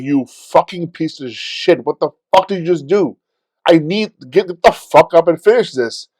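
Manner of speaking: heated self-scolding, forceful at the end; mad at his leg. Retold or imitated. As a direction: berate your injured leg, rising to a yell. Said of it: "so mad at my leg".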